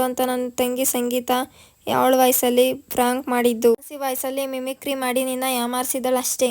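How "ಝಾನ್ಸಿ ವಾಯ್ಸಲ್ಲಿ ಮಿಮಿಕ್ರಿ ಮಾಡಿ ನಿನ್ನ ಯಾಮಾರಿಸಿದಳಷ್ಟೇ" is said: ordinary delivery, nothing marked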